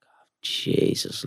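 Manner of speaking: slow breathless Scottish-free whisper of dismay; whispered panic. Retold or imitated. Footballer hears he's called up, whispering oh Jesus. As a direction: hush it out like a shocked aside.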